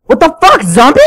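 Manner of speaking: high-pitched shocked outburst; alarmed, voice jumping up; startled panic. Real, not acted. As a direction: yelp in alarm, then protest in disbelief. Startled by a zombie in game.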